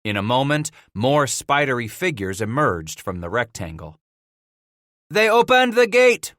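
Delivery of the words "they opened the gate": high, loud call of news; announcing. A narrator's reading. One of the engagés alerts the watchers, announcing loudly. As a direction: call it out to the whole party. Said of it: "one of the engagés announced".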